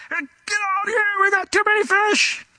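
very high, strained mock holler; calling from afar. Fishermen beckoning partners in another boat, high mock calling out. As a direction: holler across the water in a squeezed high voice. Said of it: "they're too far away to talk".

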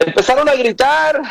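high-pitched emphatic call. Shown as a demonstration. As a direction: lift the phrase up high like a cry. Preacher acts out the shouting word.

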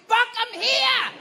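high, loud, drawn-out call; hammed-up cry to be found; mock yell. Stuck in the dress, calling to staff, mock yell. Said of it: "and I'm like".

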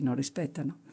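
dropped low and soft, an afterthought; quiet echo. A narrator's reading. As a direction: mutter the echo under the breath. Narrator repeats the verb as echo. (eco sommesso, quasi tra sé)

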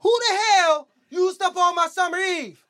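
high, drawn-out angry holler; mad. Retold or imitated. Girlfriend demanding who used her soap, mad holler. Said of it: "She got mad".

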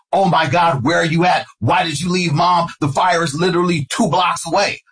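high-pitched panicked phone plea, retold; retold frantic questioning; freaking out. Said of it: "freaking out".

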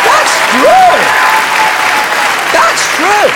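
very high, loud squeal; incredulous squeal. Real, not acted. Comic insisting to the crowd it's true, squealed. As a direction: shriek it in disbelief. Crying out at the irony.

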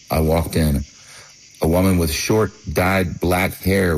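usual speaking voice

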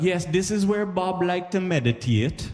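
high, slow, put-on guide voice; Jamaican tour guide. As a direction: present it like a solemn tour guide. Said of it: "So they'd be like".

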